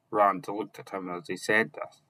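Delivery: usual speaking voice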